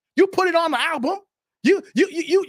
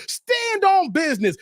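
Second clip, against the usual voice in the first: normal talking voice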